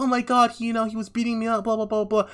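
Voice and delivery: high, whiny imitation of crying; mock crying. Girl tells him she was beaten, mock tearful whine. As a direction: pitch it way up like a tearful complaint. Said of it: "she starts crying".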